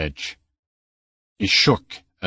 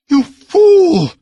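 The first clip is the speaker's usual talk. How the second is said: even higher, louder scream; furious scream, higher; 10 dB louder than usual. A narrator's reading. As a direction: scream it out, beside herself. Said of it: "screamed Balessa almost beside herself".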